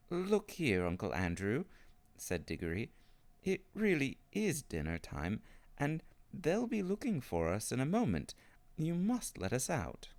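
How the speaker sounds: put-on boy standing up to his uncle; insistent boy. A narrator's reading. Narrator voices Diggory insisting.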